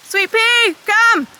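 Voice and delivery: high, loud call across a field. Real, not acted. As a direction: call her in from far away.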